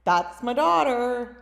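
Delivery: proud dad. Retold or imitated. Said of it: "Dad was always like".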